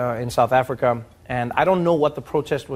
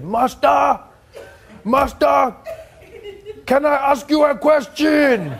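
much higher, loud, slow mock deference through laughter; mock humble grandfather; 11 semitones higher, 4 dB louder, slower than usual. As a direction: play the cheeky polite old man, drawn out. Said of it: "he said".

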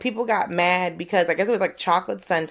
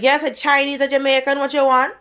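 brisk, louder, higher shop-lady voice, a hint of Jamaican lilt; impatient Jamaican lady; 8 semitones higher, 5 dB louder than usual. Restaurant lady snapping at a new customer, brisk and loud. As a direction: rush the customer like a busy cashier.

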